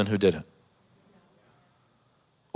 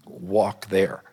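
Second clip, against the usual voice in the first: mouthed silently; 2 semitones lower, 4 dB quieter, much slower than usual. Retold or imitated. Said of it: "I was trying to say".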